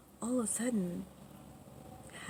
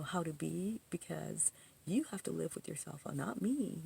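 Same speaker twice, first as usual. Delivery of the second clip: normal talking voice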